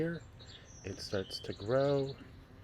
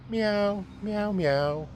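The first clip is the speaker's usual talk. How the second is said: much higher, louder meows; cat meowing; 11 semitones higher, 7 dB louder, much slower than usual. Acted. Dog calling the cat, high loud meowing.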